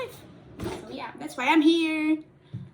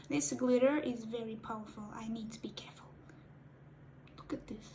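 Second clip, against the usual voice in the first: softer, unvoiced whisper; 7 dB quieter, faster than usual. Real, not acted. Warning viewers about powerful glitter, a hushed whisper. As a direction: breathe it out carefully.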